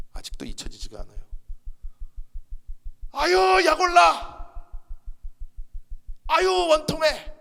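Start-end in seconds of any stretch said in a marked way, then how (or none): 3.1-7.3 high, loud, slow wail of a frustrated demon; vexed demon. Retold or imitated. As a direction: shriek it out like a spirit seething with spite. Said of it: "귀신이 막 약올라서 어쩔 줄은 몰라요".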